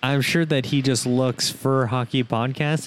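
ordinary talk

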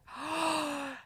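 re-enacted horrified gasp; shocked gasp. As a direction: gasp softly as the realisation hits. Acts out discovering open window.

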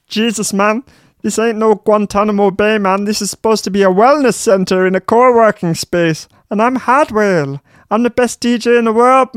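high squeaky whiny Dutch DJ. Acted. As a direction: play a pompous squeaky superstar. [name] voice again.

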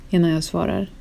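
plain reading voice

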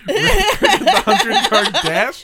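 high, loud laughing; cracking up mid-sentence. Real, not acted. Laughing at the lie.

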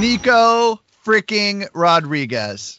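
big booming announcer intro. Acted. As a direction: hype him up like a ring announcer. Announcing a guest on the show.

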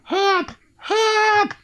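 high shrill mock help cries. Host imitating the victim screaming for help, high.

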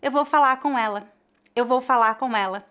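quick speech, the R of falar dropped; rapid relaxed Brazilian speech; fast casual. Shown as a demonstration. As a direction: rush it like everyday talk. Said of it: "Quando falamos rapidamente".

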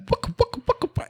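very high mouthed funk riff; imitated cheesy seventies soundtrack; seventies porn music. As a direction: mimic a wah-wah guitar in a squeak. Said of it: "seventies porno music".

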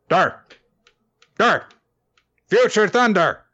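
high, robotic squawks rising each time. Retold or imitated. Robot head on the microwave squawking at hero, robotic. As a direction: bark it out like a glitchy toy robot.

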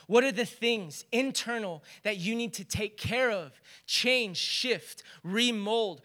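building from open asking to loud, pleading fervour; fervent prayer. Shown as a demonstration. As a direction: pray it out with rising passion. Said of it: "actually asked God what he wanted of us".

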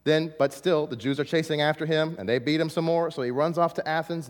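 ordinary talk

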